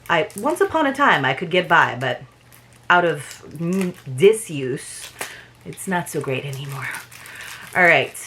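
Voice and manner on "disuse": ordinary delivery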